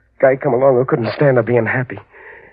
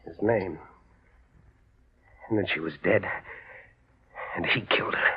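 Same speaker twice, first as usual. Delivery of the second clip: much softer, almost breathless; hushed grieving; 5 semitones lower, 12 dB quieter than usual. Acted. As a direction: let it drop to a broken hush. Hushed grief after murder story.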